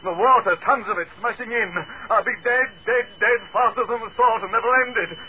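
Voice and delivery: screaming, very high and loud; wild screamed threat, voice at breaking pitch; hysterical panic. Acted. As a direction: shriek in desperate, unravelling terror. Hysterical threat to smash the glass.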